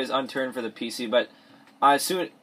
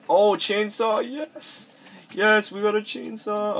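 high, drawn-out delighted cry; real thrill at a lucky find; excited discovery; 9 semitones higher, much slower than usual. Real, not acted. Delighted at finding a chainsaw.

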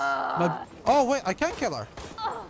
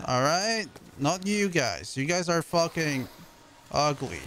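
ordinary delivery

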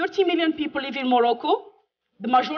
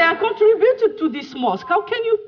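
mock indignant protest; 9 semitones higher, 5 dB louder than usual. Retold protest at mosque.

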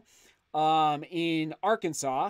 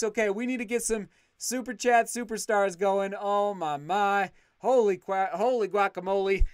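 ordinary talk, nothing marked

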